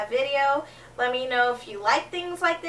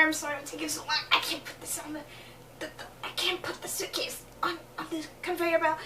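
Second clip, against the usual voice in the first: much softer, hurried apology; flustered mumble; 9 dB quieter, faster than usual. Retold or imitated. Apologizing to the strong guy, soft and hurried. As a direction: mumble it while straining. Struggling with suitcase.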